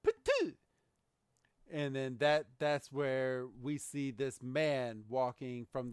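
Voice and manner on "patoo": high, sharp spitting noise; mock spit.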